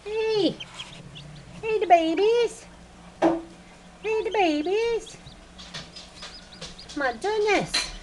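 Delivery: high cooing voice. Real, not acted. Keeper greeting the baby chicks, high baby talk. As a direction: coo high at tiny animals.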